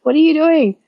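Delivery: high surprised exclamation; shocked friend seeing her haul the pool, high; mock shocked. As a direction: play the startled friend, voice jumping up.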